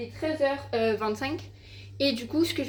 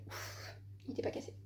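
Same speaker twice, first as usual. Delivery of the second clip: whisper; 16 dB quieter than usual. Real, not acted. Relief that laptop survived.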